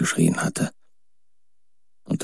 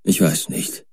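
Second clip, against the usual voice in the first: plain reading voice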